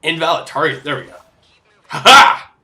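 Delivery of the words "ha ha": loud, high burst of a cry; triumphant ha-ha. Pretend. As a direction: let out a sudden attack cry. Exclaims while attacking in game.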